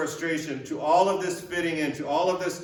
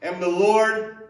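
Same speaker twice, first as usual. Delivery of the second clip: stern yet loving God; 3 semitones higher, 3 dB louder than usual. Retold or imitated. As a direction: boom it out like a stern, loving Lord. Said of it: "this stern yet loving reminder".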